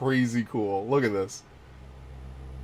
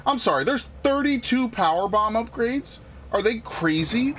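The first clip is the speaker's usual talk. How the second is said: high-pitched questioning disbelief; incredulous; 9 semitones higher than usual. Real, not acted. Player baffled by the number of power bomb upgrades, incredulous. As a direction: question it as if it's absurd.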